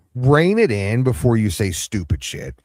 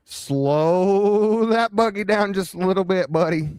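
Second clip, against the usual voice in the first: high-pitched interrupting; 13 semitones higher than usual. Real, not acted. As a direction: stretch it out high like reining in a horse. Cuts in to halt the speaker.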